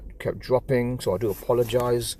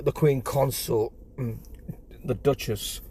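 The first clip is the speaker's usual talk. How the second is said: ordinary delivery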